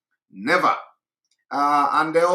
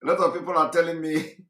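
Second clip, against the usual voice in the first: normal talking voice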